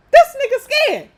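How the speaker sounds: a loud, high hint of [name]. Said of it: "I gotta say that in my [name] voice".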